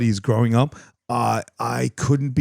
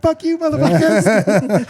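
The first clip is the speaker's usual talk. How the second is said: high laughing taunt from behind a big man; mock taunt; 13 semitones higher, 4 dB louder, slower than usual. Teen taunting the gang from safety, laughing high. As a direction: jeer like a cocky kid now protected.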